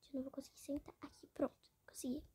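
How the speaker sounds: whispered to herself while sitting. Real, not acted. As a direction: hush it out, barely voiced.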